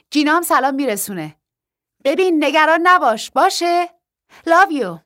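over the phone, bright and loud; excited phone call. Acted. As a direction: gush down the line, all good news. Wife calling on the phone.

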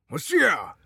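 موسیا yelled high and loud; loud shout. A narrator's reading. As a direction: bellow موسیا at full force. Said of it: "دوباره داد زد". (فریاد بلند و زیر «موسیا»)

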